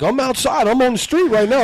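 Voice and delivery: high-pitched, insistent challenge; mock threatening caller. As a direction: imitate a caller itching for a fight. Imitating the prank caller's threat.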